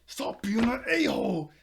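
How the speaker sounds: high, loud acted telling-off; mock angry outburst. Ren to Bond just before punching him, mock angry outburst. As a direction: blurt it out loud in mock fury.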